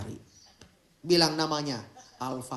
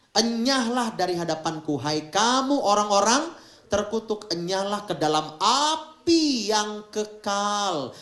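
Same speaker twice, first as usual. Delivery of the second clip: stern proclamation; 7 semitones higher, 9 dB louder than usual. Acted. Reading out Jesus' condemnation.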